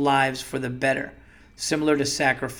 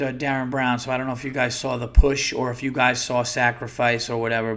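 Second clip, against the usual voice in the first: normal talking voice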